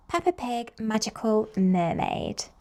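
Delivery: usual speaking voice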